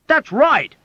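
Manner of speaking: very high, loud agreeing shout; emphatic cry. Acted. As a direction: cry it out with full conviction. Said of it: "cried emphatically".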